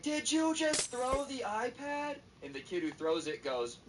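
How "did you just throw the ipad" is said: high, slow, drawn-out disbelief; mock outraged question. Cousin confronts the kid who threw the iPad, mock outrage. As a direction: stretch out the accusation in a high voice.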